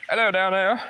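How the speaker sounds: loud, high call down below; calling down from tower. Real, not acted. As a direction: shout it down to people far below. Calling down from height.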